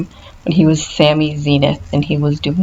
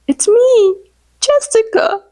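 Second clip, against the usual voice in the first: high, louder "It's me, Jessica"; a high, perky girl voice; Hot Chick quote; 3 dB louder, slower than usual. Retold or imitated. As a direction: announce yourself in a high sweet voice. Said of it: "another one that I say a lot".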